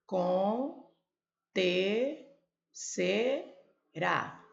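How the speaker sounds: acontecerá split into very slow separate syllables. Shown as a demonstration. Teacher counting syllables for the class. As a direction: spell out acontecerá beat by beat. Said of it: "Olha quantas sílabas tem".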